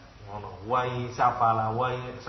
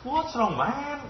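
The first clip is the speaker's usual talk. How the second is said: high, slow, baffled what's wrong; much higher incredulous English question; puzzled English speaker; 12 semitones higher, much slower than usual. Acted. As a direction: ask it as a bewildered foreigner. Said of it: "Ngono, dia ngomong".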